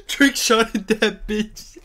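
wheezing through the words, slow and high; can barely talk for laughing. Real, not acted.

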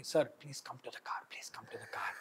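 hushed, secretive whisper. Retold or imitated. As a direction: whisper it so nobody else hears. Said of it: "He's, uh, telling silently".

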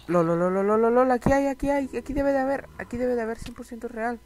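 high, eager rush of anticipation; excited. Real, not acted. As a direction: get worked up over a promising find. Cree haber hallado diamantes. (emocionado, con voz aguda)

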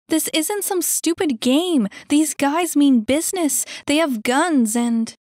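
high, loud, urgent warning; girl insisting the danger is serious; agitated Annie. Acted. Annie warns the kid.

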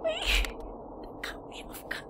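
breathless, slow, wheezing it out through laughter; laughed out of voice, barely voiced; wheezy laughing. Real, not acted. Streamer repeats the line while wheezing with laughter. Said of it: "I'm laughing".

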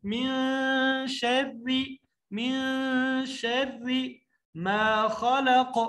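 slow, drawn-out tajweed chant; teacher reciting verse slowly; Quranic recitation. Shown as a demonstration. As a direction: recite it long and measured.

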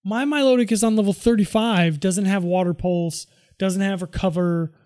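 ordinary talk, nothing marked

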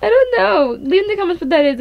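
high, loud frightened laughing; startled scream-laugh. Real, not acted. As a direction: shriek in nervous fright. Startled by a face.

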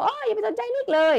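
high-pitched exclaimed yell; mock shout. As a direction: shout it up like a severed head on the floor. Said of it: "มันก็เลยตะโกนขึ้นมาว่า".

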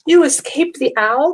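high, loud overjoyed question; joyful cry. A narrator's reading. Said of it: "cried Stellaluna".

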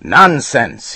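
high, loud angry shout. A narrator's reading. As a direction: snap it back at him. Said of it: "he shouted".

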